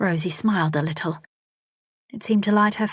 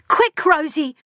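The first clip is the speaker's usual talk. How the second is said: much higher, louder urgent shout; urgent cry; 10 semitones higher, 5 dB louder than usual. A narrator's reading. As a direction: yell for her sister now. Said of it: "Elsa cried".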